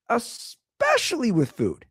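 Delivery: mock earnest repeat. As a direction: lean in slowly on it. Comic repeat.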